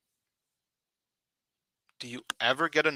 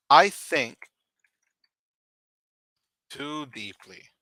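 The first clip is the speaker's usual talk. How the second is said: slow model answer; 4 dB louder, slower than usual. Shown as a demonstration. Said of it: "You could say".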